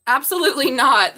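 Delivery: loud, startled absolutely not; surprised outburst. Real, not acted. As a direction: blurt it out in shock. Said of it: "That popped out right in my hand".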